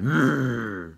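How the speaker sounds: a closed-mouth hum, pushed loud and high; loud hummed roar. Shown as a demonstration. Showing the girlfriend's loud noise, a loud hummed roar. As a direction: hum a monster roar through closed lips. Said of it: "making that loud".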